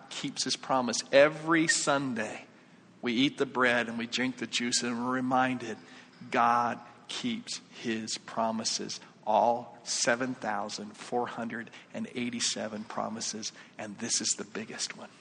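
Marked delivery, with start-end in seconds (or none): 6.3-15.1 slow, low, soft conviction; solemn assurance. Real, not acted. Preacher closes before communion prayer, solemn and slow. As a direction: weigh each word, hushing toward the end.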